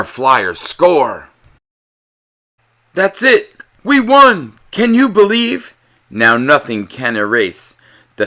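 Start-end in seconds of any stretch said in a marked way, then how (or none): 2.9-5.7 high, loud victory cheering; jubilant cheer. Acted. The fans celebrating the win, cheering loudly. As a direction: shout it like you just won. Team wins the game.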